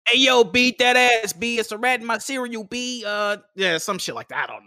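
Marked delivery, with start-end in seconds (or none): 0.1-3.0 New York accent. Shown as a demonstration. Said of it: "can we get your best New York accent".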